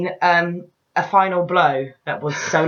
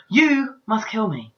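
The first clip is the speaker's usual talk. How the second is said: solemn heroine; 3 semitones higher, slower than usual. Retold or imitated. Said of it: "turns around to the king and says".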